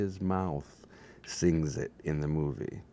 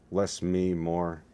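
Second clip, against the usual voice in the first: ordinary talk, nothing marked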